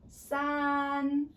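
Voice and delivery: "san" held high and level; flat first tone. Shown as a demonstration. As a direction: stretch "san" on one steady high pitch. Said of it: "It's high pitch and consistent".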